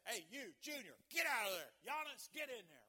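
loud, punchy acted sideline shout; coach's yell. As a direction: holler like a coach swapping players. Acts coach yelling substitutions at players.

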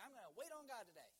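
punched-up, confident resolution; keen resolve. Acted. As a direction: declare it with fresh morning enthusiasm. Acted keen morning resolve, then reversal.

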